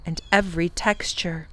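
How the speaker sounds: ordinary delivery, nothing marked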